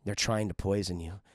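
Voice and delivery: very low, ominous voice; a menacing inner voice giving warnings; low, sinister intoning; voices in head. Retold or imitated. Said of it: "the voices start coming in".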